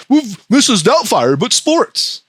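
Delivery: high goofy mock voice; mocking pitch voice. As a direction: pitch it way up to ridicule a dumb idea. Mocks the show's pitch.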